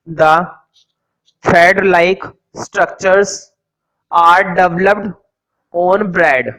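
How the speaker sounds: each word spaced out slowly for note-taking; teacher dictating notes to the class slowly; slow dictation. Acted. Teacher to students copying notes, slow dictation.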